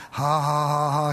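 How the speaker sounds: low, monotone mock chuckle; steady, deep-pitched ha-ha-ha; Brother Hagin. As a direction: imitate a private laugh kept under the covers. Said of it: "he was just laughing real quietly".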